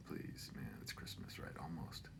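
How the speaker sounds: whispered plea, almost no voice. Real, not acted. Whispering an aside to viewers, pleading under breath.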